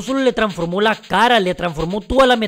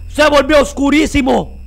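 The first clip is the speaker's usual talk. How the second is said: panicked screams; 6 semitones higher than usual. Real, not acted. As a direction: shriek at the screen in real fright. Dark creature appears, he panics.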